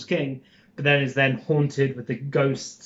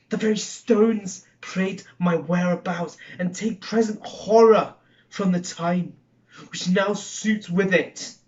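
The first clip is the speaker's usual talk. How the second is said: theatrical Macbeth soliloquy, Scottish-tinged; haunted murderer wrestling with a vision of a dagger; dramatic verse recitation, rising to bursts of force; Shakespeare monologue; 4 semitones higher than usual. Acted.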